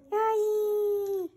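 usual speaking voice

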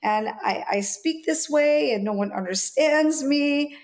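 lightly voiced kid whining; child's complaint. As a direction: voice her younger self complaining to mom. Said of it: "I was like".

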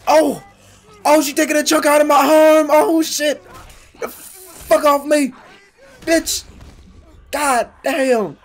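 high, loud frantic screaming; panicked shouting. Real, not acted. Gamer reacting to a zombie biting their arm, panicked shouting. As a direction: shriek as if being bitten.

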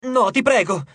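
pleading cry. A narrator's reading. Hazel pleads with the bully over the diamond, crying out. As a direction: cry out a plea, without full volume. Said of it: "gridò Hazel".

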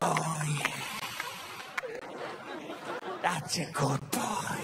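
low, drawn-out priest voice; priest behind the wall. Acted. Priest to child in confession box, low and slow. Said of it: "on the other side of the wall".

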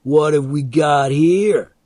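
loud, drawn-out call over a storm. A narrator's reading. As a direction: call it out slowly over the noise. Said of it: "calling above the noise of the storm".

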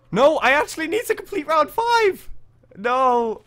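loud, high cry of protest; dismayed shout. Real, not acted. Player learns he must complete round five, dismayed shout. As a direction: protest at the top of your voice. Fails the challenge at the deadline.

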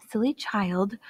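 a soft, hushed murmur. Acted. Faya to Hannah, hushed and uneasy. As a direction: hush it, as if afraid of being overheard. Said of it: "her voice curiously hushed".

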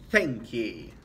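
a grudging pirate thank ye. A narrator's reading.

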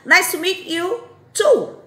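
other speaker's reply. Shown as a demonstration. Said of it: "Aí a pessoa responde".

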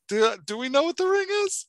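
quick, high, doubtful self-quote; checking with the author, half-puzzled; unsure question. Retold or imitated. Reader asking herself about the ring, high and unsure. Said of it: "I'm like".

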